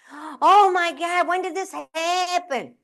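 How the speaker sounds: high, very slow mock panic; alarmed sister. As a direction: stretch out the shocked sister's words. Sister's alarmed questions continue.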